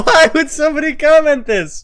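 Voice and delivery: real high, loud, laughing disbelief; shocked outburst. Real, not acted. Streamer reading a mean comment under a video, shocked outburst. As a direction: shriek in disbelief through laughter.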